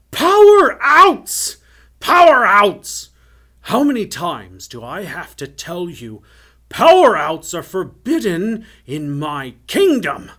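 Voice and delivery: enraged tyrant roaring, high and loud; infuriated scream. A narrator's reading. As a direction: scream it slowly at your subjects, punching the key words. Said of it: "Obviously infuriated, the man who loved fire lifted his red head and screamed".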